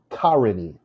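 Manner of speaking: "Karini" as KAR-ih-nee; misstressed "Karini", KAR-ih-nee; stress on first syllable. Shown as a demonstration. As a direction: hit the start of "Karini", short weak middle. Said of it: "If the first one is stressed".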